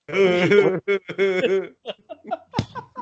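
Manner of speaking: loud, high put-on laugh; Bourbon laugh. Shown as a demonstration. Showing a friend his own laugh, loud exaggerated imitation. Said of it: "The Bourbon laugh".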